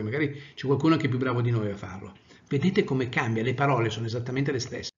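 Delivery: ordinary talk, nothing marked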